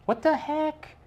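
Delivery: high startled squeak; surprised. Real, not acted. Husband finding a broken egg, high surprised squeak. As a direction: shoot the voice way up in shock.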